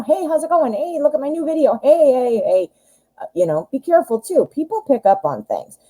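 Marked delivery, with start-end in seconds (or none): 0.0-2.7 high, quick, eager pestering voice; pestering texter. Retold or imitated. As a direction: chirp it like a pushy texter. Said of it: "don't bombard this person with".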